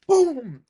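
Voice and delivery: loud, high shouted boom; booming impact. Retold or imitated. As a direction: shout the smash as one big blast. Imitates pickaxe smashing the door.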